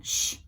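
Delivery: sharp little hushing sound; shush. A narrator's reading. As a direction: shush them during the play. Hushing whisperers at the play.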